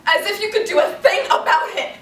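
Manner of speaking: loud, high burst of indignation; acted exasperated cry; bitter outburst. Outburst in dramatic monologue.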